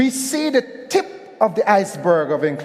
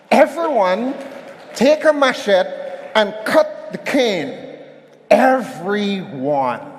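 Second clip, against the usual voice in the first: plantation overseer. Acted. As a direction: command the workers like a harsh boss. Plantation boss ordering workers.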